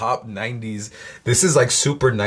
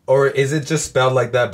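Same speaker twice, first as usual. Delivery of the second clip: normal talking voice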